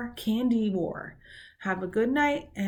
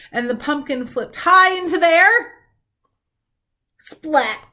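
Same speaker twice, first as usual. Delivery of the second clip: rising louder to a big splat; excited build to splat; 7 semitones higher, 8 dB louder than usual. A narrator's reading. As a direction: let the pumpkin fly, land it hard. Story climax, splat sound.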